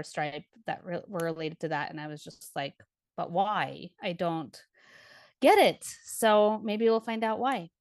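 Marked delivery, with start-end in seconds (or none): none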